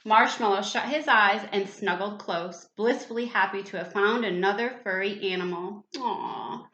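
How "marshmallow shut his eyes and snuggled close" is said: ordinary delivery, nothing marked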